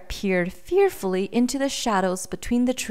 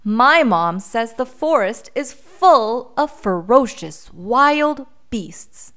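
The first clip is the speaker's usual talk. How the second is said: put-on raised, louder storybook child voice; insistent little boy; 4 semitones higher, 7 dB louder than usual. A narrator's reading. Boy warns the bear what his mom says, insistent. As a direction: warn as an earnest small boy.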